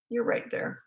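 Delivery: whispered, unvoiced, gentle; whispered reassurance. Shown as a demonstration. Mother reassuring a child mid-tantrum, in a whisper. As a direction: breathe it to the child so they feel you near. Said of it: "soft whispered repeat".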